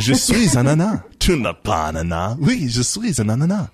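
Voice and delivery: sing-song schoolroom French, lifted high at the ends; French recitation. Pretend. Host showing off the only French they remember, playful French recitation. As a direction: play at French with a remembered TV phrase. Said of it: "That's the only French I remember".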